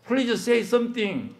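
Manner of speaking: high, slow desperate plea to God; pleading cry. Retold or imitated. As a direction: beg slowly, voice lifted high. (높고 느리게 하나님께 애원하듯)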